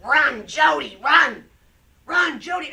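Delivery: very loud, high shouted warning; full-out yell. Retold or imitated. Actress redoing her one line on set, shouted at full force. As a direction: belt it into the mic like someone running for their life. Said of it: "three times I go".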